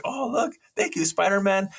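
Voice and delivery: very high, excited fan squeal; starstruck fan voice. Retold or imitated. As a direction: gush like a dazzled barista. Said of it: "the guy in the coffee shop's like".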